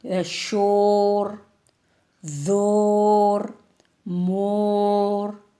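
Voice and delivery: each word stretched long; very slow words. Shown as a demonstration. Teacher reading rhyming words very slowly to class. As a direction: draw out every rhyming word. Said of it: "reading rhyming words slowly".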